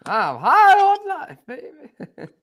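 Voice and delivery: high, drawn-out gleeful cry; mock wild euphoria; hammed-up exuberance. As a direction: stretch it out, soaring way up in pitch. Clowning on the catchphrase.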